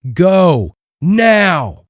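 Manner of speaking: sharp high order; commanding shout. A narrator's reading. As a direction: bark the order to jump. Marshall orders Dwayne to jump.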